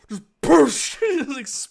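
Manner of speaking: excited laughing yell at the table; laughing shout. Real, not acted. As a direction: burst out laughing as you yell it. Laughing at exploding corpse idea.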